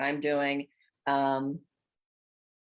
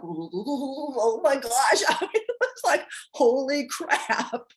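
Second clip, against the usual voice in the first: high, slow, amazed gasping words; stunned exclamation; 10 semitones higher, 4 dB louder, slower than usual. Retold or imitated. Body reeling in awe at the healing, amazed exclamation. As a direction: let the body's shock spill out, drawn out. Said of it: "my body was just like".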